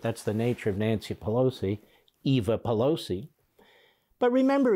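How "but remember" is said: high, louder mock reminder; mock emphasis. Host introduces parody of congressman's argument, mocking. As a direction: jump up high and loud, mockingly.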